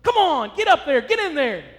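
loud, high corner-man shout; trainer Mick yelling. Retold or imitated. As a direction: fire up the beaten boxer. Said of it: "They're pushing him".

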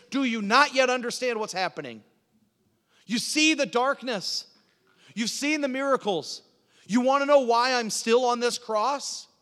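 high, loud, urgent challenge; impassioned sermon from the cross; Jesus preaching. Acted. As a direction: demand that the crowd understand. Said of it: "This is the last sermon of Jesus Christ saying".

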